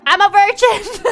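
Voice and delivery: high, loud, slow nervous laughing blurt; panicked blurt. Retold or imitated.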